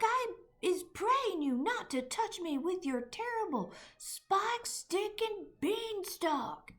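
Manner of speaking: high, loud desperate pleading; begging terrified giant. A narrator's reading. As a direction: grovel for mercy, voice climbing. Giant begging Jack in nightmare.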